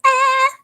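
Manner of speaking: sudden high yelp of surprise; a short, sharp cry of surprise; startled yelp. Real, not acted. Startled while finding the brush.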